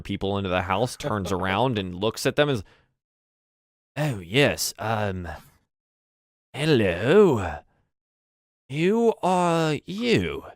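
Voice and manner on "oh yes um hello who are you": stiff butler, last question high and drawn out; slight disdain. Acted. Butler notices the lord's guests, with slight disdain. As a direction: greet them haltingly, then stretch the question snootily. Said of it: "a slight disdain in his voice".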